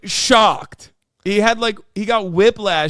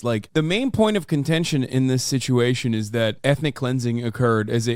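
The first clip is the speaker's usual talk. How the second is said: ordinary talk, nothing marked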